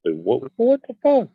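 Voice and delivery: ordinary talk, nothing marked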